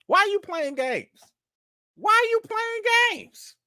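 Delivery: hammed-up high-pitched complaint; mock exasperation. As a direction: playfully wail it in disbelief. Mock confrontation with a viewer.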